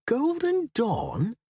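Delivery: doubting what he just heard; incredulous. A narrator's reading. Firth questions the name.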